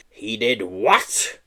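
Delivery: outraged bellow. Acted. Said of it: "bellowed an outraged Mister Mincebrains".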